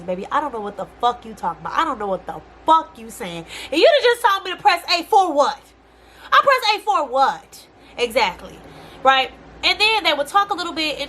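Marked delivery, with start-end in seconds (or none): 3.7-7.7 louder, higher indignant question. Real, not acted. Vlogger fuming at the China Post phone menu, indignant. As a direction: demand it like you were cheated.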